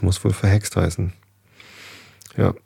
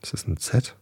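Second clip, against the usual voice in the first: ordinary delivery, nothing marked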